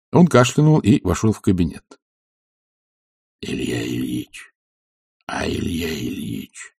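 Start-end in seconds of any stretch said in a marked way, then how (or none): 3.4-6.7 soft, low, drawn-out call; quiet calling. A narrator's reading. Servant at the sleeping master's bedside, calling quietly. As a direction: call him gently, slow and hushed. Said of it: "начал он тихо".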